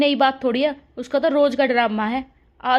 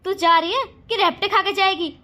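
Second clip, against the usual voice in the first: higher, sharp scolding orders; bossy scolding; 6 semitones higher than usual. Acted. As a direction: boss the younger daughter-in-law around. Elder sister-in-law orders younger.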